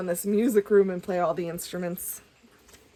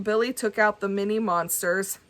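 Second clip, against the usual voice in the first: ordinary delivery, nothing marked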